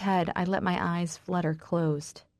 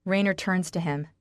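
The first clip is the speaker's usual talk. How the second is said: ordinary delivery, nothing marked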